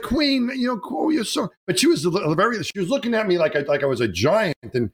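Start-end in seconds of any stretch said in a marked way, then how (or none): 0.0-1.5 high excited gush; gushing at the Queen. Retold or imitated. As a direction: starstruck babbling at the Queen. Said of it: "I was like".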